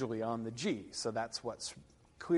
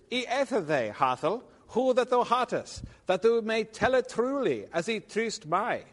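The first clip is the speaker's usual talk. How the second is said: put-on declaimed old verse, louder and higher as the knight speaks; Middle English verse read aloud; 4 semitones higher, 7 dB louder than usual. Lecturer reading Gawain's meeting with the Green Knight, declaimed. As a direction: recite it with rolling, deliberate emphasis.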